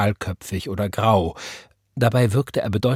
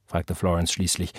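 usual speaking voice

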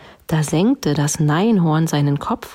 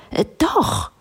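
high insistent contradiction; calling princess; 5 semitones higher than usual. A narrator's reading. Princess answering a refusal, high insistent call. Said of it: "rief die Prinzessin".

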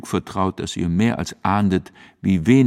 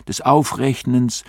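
ordinary delivery, nothing marked

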